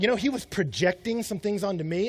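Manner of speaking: ordinary talk, nothing marked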